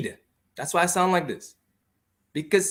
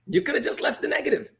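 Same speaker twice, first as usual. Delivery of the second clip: repeats it through a laugh, pitched up; 9 semitones higher than usual. Real, not acted. Host laughs back at the objection, amused and mocking. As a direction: laughs at the idea of just leaving the negative.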